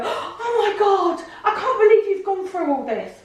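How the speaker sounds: high, loud imitation of a fan, slowing on God; mock the starstruck stranger with a laugh; high-pitched hammed-up sympathy; gushing fan. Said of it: "people come up to me and go".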